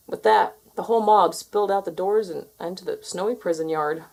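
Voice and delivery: ordinary delivery, nothing marked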